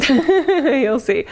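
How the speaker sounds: giggling, pitch jumps up; laughing. Real, not acted. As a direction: laugh through the words. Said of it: "well, you'll see".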